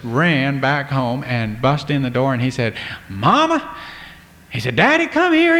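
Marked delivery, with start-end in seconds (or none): 3.1-3.7 high, loud excited shout for Mama; a boy bursting in with big news; excited call. Acted. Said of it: "he got excited". 4.8-5.6 very high, loud urgent call; a boy bursting through the door, can't wait; excited shout. Acted. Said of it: "he got excited".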